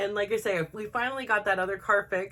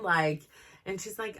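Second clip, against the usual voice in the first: ordinary delivery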